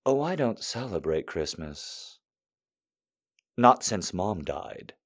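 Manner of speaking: soft, slow fake-mournful; milk the sad orphan line, quietly; playing dead mama. Customer guilting the saleswoman, soft slow mock grief. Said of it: "I already played dead mama".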